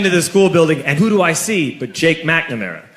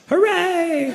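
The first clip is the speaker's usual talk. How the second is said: high, bright mock hooray; mock cheer; 13 semitones higher, 4 dB quieter than usual. Comic telling the crowd, a mock party cheer. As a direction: cheer it like a kid hearing party news.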